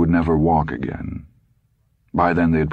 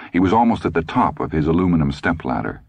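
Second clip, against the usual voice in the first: ordinary delivery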